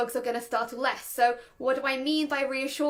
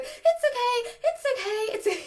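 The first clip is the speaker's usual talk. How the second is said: high-pitched, urgent panicky repeat; high, anxious fussing over a dog; panicked reassurance; 11 semitones higher, 4 dB louder than usual. Shown as a demonstration. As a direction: chant it like a startling mantra, panic in the voice. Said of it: "because I'm a little bit panicked too".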